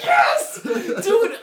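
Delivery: loud, high shout through laughter; excited shouting. Real, not acted. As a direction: yell it in thrilled agreement. Excited agreement about all-seeing eye.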